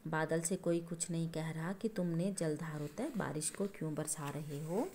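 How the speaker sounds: normal talking voice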